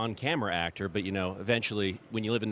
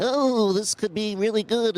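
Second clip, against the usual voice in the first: much higher, slow voice with the nose pinched; 11 semitones higher, 4 dB louder, slower than usual. Shown as a demonstration. Showing a voice trick, speaking with the nose pinched shut. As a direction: pinch your nose and talk. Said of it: "you can pinch your nose".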